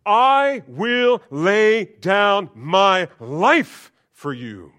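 drawn-out boastful declaration; Peter's boast. Acted. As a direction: stretch it out like a bold promise. Peter boasting, voiced loud.

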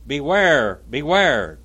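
loud, forceful warning cry; urgent warning. A narrator's reading. Wife at Pilate's feet warning him, urgent and loud. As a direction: ring out each word as a plea. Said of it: "Weeping and throwing herself at my feet".

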